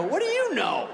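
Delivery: very high, alarmed comeback; panicked retort. Retold or imitated. Answering the threat of being next upstairs, panicked.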